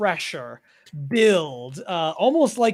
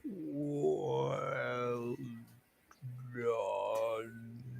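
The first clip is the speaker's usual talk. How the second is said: very slow, soft, low drawn-out words; Capitan Cloud; 7 semitones lower, 15 dB quieter than usual. Acted. Game master voicing a captain as he vanishes, slow and hushed. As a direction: stretch each word out low and hushed. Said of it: "Capitan Cloud begin to say".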